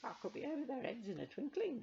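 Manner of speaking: high, sly wheedling; crafty old witch. Acted. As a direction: coax them slyly as the crone. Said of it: "she said craftily".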